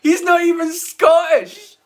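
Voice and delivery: high, exasperated protest; indignant outburst. Real, not acted. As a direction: protest it in high-pitched outrage. Outrage at a non-Scot playing one.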